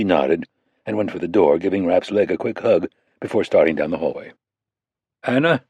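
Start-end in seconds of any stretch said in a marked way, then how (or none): 5.2-5.6 calling after her. Acted. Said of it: "Mitch called after her".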